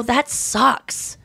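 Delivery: gently sorry-for-him "That sucks."; sympathetic sigh. Retold or imitated. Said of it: "I just go".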